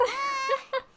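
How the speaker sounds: short, high burst of laughter; helpless laugh. Real, not acted. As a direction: laugh it off, stumped. Laughs at the puzzling question.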